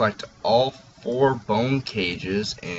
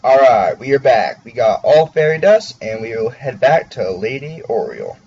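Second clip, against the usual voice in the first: excited; 3 semitones higher, 10 dB louder than usual. Real, not acted. Returns triumphant from fairy fight.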